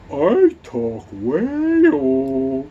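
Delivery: very slow, high, swooping whale call; loud drawn-out wail sliding upward; whale talk. Acted. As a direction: stretch it out like a whale. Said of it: "we're talking in Wales".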